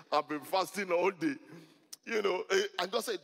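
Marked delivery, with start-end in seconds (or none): none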